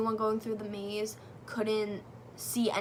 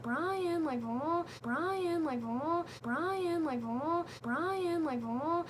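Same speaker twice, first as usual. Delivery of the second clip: high, sing-song haunting call; spooky sing-song call; 5 semitones higher, much slower than usual. Acted. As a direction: lure them in with a creepy sing-song. Calling out a visitor's name spookily.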